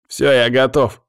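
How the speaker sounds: loud, eager exclamation; exclaiming. A narrator's reading. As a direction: call out that you're ready. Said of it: "воскликнул Павел".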